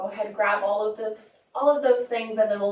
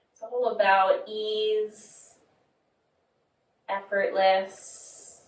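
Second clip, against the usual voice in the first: guided meditation. Acted. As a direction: guide them inward, leaving long pauses between phrases. Leads body scan meditation.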